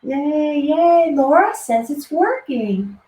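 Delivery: high, drawn-out yay of relief; happy cheer. Real, not acted. Host glad Laura says it's working, happy cheer. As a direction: celebrate it slowly, up high. Celebrating that sound works.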